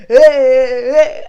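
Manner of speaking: loud excited hey hey hey; hype shout. Retold or imitated. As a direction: shout it like a fan at a concert. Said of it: "I'd be like".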